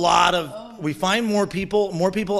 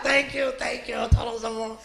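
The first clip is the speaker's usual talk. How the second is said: grateful demons; 7 semitones higher, 6 dB quieter than usual. Retold or imitated. Demons thanking, high soft.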